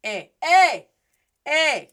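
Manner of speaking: loud, high eh called out; attention-getting vowel. Shown as a demonstration. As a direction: call "eh" as if hailing someone. Repeats the attention call as vowel.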